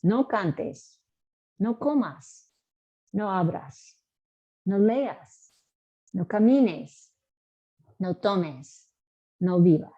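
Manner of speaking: negatives modelled one by one with pauses; teacher drilling negative commands; slow drill for repeating. Shown as a demonstration. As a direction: lean on no and the endings. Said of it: "Now we'll do the negatives".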